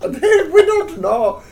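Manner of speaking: slow laughing squeak. Real, not acted. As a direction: giggle it slowly. Laughing mock voice.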